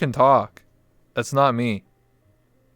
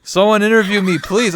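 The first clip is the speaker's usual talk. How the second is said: pleading; 9 semitones higher, 7 dB louder than usual. Acted. Begging to be interviewed.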